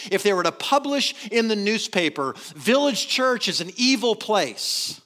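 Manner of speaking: loud, driving sermon delivery; full-force preaching. Acted. Pastor challenging his church, loud preaching voice. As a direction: press the congregation hard.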